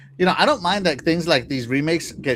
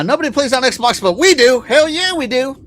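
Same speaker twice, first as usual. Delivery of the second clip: louder, higher, rallying the fans; hyped shout; 8 semitones higher, 6 dB louder than usual. Real, not acted. Host rallying Xbox fans, hyped defiant shout. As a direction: Hell yeah, we do, pump the crowd.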